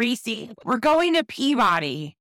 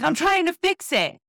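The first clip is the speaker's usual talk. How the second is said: mock husband; 10 semitones higher than usual. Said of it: "he's like".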